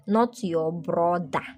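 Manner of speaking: normal talking voice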